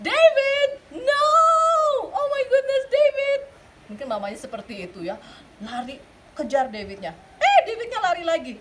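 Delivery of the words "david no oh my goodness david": loud, high mock-shocked shout; mother shrieking at the runaway boy. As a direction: yell after the naked boy, half laughing. Mother chases naked David.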